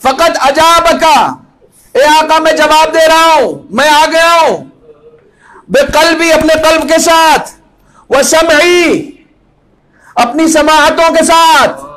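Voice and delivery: high, loud impassioned address to the Imam; full-force preaching. Real, not acted. Preacher speaking to his master Hussain, impassioned and loud.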